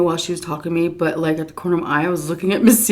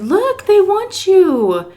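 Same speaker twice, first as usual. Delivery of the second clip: much higher, drawn-out sing-song; high slow coaxing; 14 semitones higher, 6 dB louder, much slower than usual. Retold or imitated. Caregiver showing Miss C the cats, high slow coaxing. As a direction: coax her gently toward the cats.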